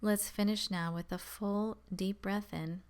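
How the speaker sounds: calm, measured meditation voice with long pauses; guided meditation. Acted. Guiding beginners to focus on the breath, calm meditation voice. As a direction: settle listeners gently, leaving space between instructions.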